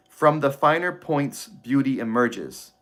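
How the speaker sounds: slow, weighty reading of the line; slow deliberate reading. Shown as a demonstration. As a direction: let each word land slowly. Reads a favorite book line aloud.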